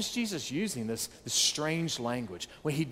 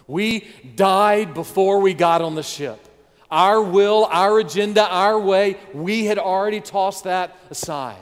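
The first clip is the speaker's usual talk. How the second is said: loud, climactic sermon cry; full-throated pulpit preaching; preaching at full force; 5 semitones higher, 10 dB louder than usual. Acted. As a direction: drive it home to the congregation.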